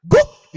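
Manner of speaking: very loud, high single bark; imitated dog bark. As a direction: burst out with one sharp woof. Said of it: "Ada yang teriak".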